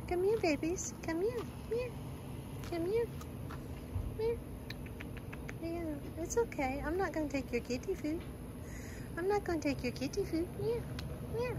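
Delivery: high, soft coaxing coo to shy cats. Real, not acted. Woman coaxing scared gas station cats, soft high coo. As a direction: sweet-talk the stray cats softly.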